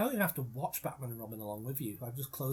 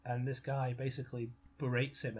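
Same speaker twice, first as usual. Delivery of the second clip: usual speaking voice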